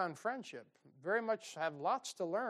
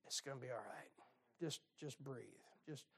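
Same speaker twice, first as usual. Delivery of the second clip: softer, lower soothing voice; calm a panicking friend gently; calm reassurance; 6 semitones lower, 15 dB quieter than usual. Shown as a demonstration. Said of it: "your friends just need you to say".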